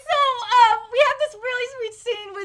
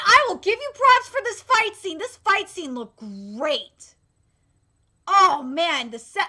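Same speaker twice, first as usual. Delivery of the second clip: ordinary talk, nothing marked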